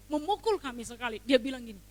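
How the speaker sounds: ordinary talk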